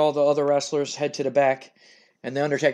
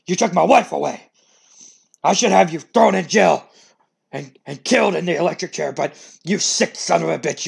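loud, high, raging accusations; furious Vince; 5 semitones higher, 8 dB louder than usual. Acted. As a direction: scream it in his face, out of control. Vince confronting wife's killer.

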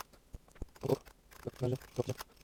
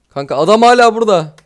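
louder, higher, alarmed; alarmed exclamation; 5 semitones higher, 8 dB louder than usual. Real, not acted. Gamer warning friend the man is still here, loud alarm. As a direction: sound the alarm to your buddy.

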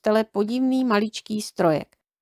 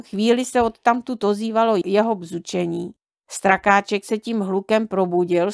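ordinary delivery, nothing marked

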